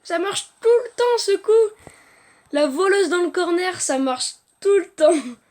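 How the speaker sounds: loud, high gamer excitement; excited exclamation. Real, not acted. S'exclame sur son coup. (exclamation aiguë et forte)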